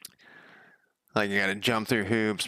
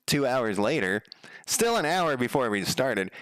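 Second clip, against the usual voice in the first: ordinary talk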